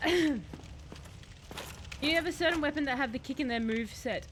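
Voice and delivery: ordinary delivery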